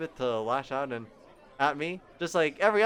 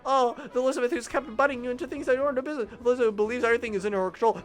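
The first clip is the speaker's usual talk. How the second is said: high, loud, rushed flustered babble; flustered inner panic; 9 semitones higher, 7 dB louder than usual. Acted. Narrator scolds himself over Elizabeth, high and flustered. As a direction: panic at yourself over a crush. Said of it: "Damn, get a grip".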